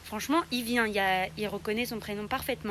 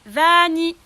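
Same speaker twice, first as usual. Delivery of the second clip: "Vanny, Vanny" called high and much louder; calling a rabbit from across the room; 6 semitones higher, 15 dB louder, slower than usual. Shown as a demonstration. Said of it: "et que je dis".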